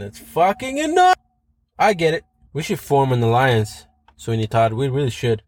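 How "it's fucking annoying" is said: exasperated burst, voice flying up; fed-up outburst at the end of a rant; annoyed outburst. Real, not acted. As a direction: pitch jumps high on the swear. Ranting about TikToks in public.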